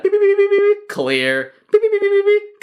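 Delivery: very high, loud electronic beeps; robot beeping. Pretend. Bouncer bot scanning people for covid, high loud beeps. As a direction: beep like a scanning machine. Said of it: "And it goes".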